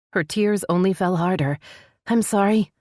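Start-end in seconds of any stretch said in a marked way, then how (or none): 2.1-2.7 tearful. Acted. Heroine voice.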